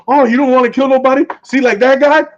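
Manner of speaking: high, fast mocking taunt; mocking Frank. Friend imitating Frank taunting Daredevil, high and mocking. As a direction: sneer it up high like a teasing tough guy. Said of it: "Frank told him".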